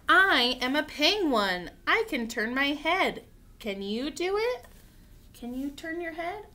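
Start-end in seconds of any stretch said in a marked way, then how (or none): 0.1-4.6 bold picture-book read-aloud; reading to children. Acted. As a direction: read it out clearly and invitingly to little ones.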